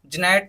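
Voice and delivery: जनेट with a J sound on the G; जनेट, G voiced as J; wrong pronunciation. Shown as a demonstration. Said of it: "जनेट नहीं पढ़ेंगे इसको".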